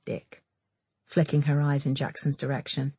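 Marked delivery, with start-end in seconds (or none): 0.1-0.4 barely voiced, very soft insult; hushed, secretive jab; mouthed aside. A narrator's reading. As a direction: mouth it under your breath to a friend. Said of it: "turned to Sam and mouthed".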